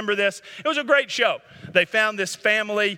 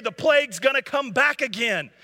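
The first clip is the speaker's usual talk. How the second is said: normal talking voice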